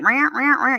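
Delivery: high, repeated security-alarm squawk; alarm imitation. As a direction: imitate a scanner going off. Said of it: "it's like".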